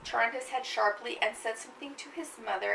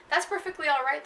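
eager grandmother; 6 semitones higher, 8 dB louder than usual. Acted. Grandmother reassuring the gunman.

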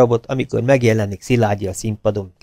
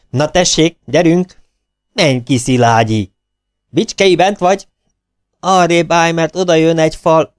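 high, loud hurried commands; director urging on; 7 semitones higher, 5 dB louder than usual. A narrator's reading. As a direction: push the actors on and off the stage. Director hurrying actors loudly.

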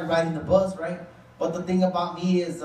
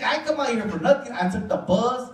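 normal talking voice

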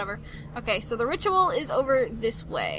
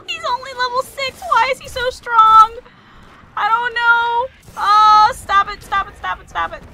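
high, loud, flustered wail; panicked complaint; 10 semitones higher, 10 dB louder than usual. Real, not acted. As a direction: cry out while getting beaten. Losing to strong enemy.

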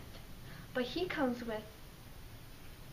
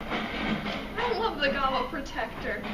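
loud forced praise; 7 dB louder than usual. Acted.

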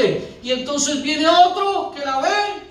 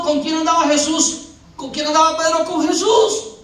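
normal talking voice

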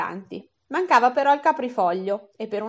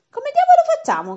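much higher, louder desperate cry; shouted; 7 dB louder than usual. A narrator's reading. Harris, stranded without a room, shouting in frustration. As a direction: blurt it out at full pitch in despair. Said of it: "gridò Harris".

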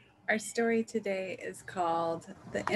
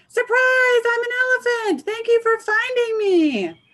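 much higher, much louder cartoon elephant; goofy elephant voice; 12 dB louder than usual. Elephant popping out of the story, shouting its surprise. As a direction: bellow it out like a silly surprise elephant.

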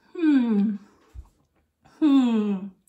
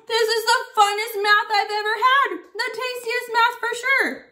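gasp, then high and thrilled; hammed-up joy over candy math; mock delight; 8 semitones higher than usual. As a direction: squeal about tasty math. Gasps over tasty math.

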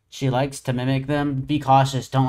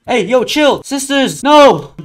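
loud, high yelling; panicked shout; 10 semitones higher, 11 dB louder, slower than usual. Real, not acted. Player attacked in the game, panicked shout.

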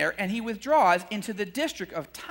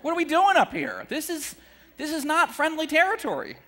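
pitched-up, increasingly louder grumbling; grumbling disciples; 6 semitones higher, 5 dB louder than usual. Retold or imitated. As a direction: act out disciples muttering complaints, building. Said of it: "They're probably thinking".

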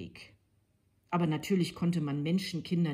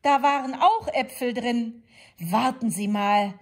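put-on higher, louder old lady voice; chatty granny recalling old times; lightly voiced reminiscing grandmother; 7 semitones higher, 7 dB louder than usual. A narrator's reading.

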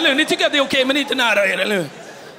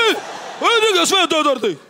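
furious tough-guy threat; 3 semitones higher than usual. Retold or imitated. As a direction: blow up like a hothead bully. Zoran threatening to kill.

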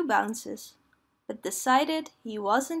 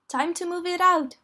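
higher, rousing call to work; crying out; 6 semitones higher, 4 dB louder than usual. A narrator's reading. Rabbit to everyone loading the wagons, calling out. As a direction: call out to get the helpers moving. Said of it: "cried Rabbit".

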